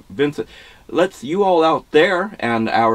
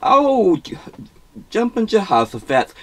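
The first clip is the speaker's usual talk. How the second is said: surprised; 6 semitones higher than usual. Acted. Startled by the caller.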